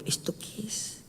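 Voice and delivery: voiceless whisper of "¿Esto qué es?"; inner thought whispered. Retold or imitated. Thinking to himself during the tongues prayer, whispered. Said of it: "Y yo por dentro".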